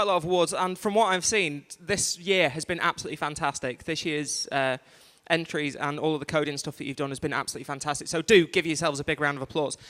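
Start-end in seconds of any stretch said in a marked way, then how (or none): none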